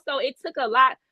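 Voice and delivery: ordinary talk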